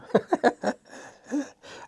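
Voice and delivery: breathy high chuckle at own dark joke; real laugh. Real, not acted. Painter to viewers, laughing at his own joke. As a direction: laugh out, then catch yourself. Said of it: "Uh, I'm laughing".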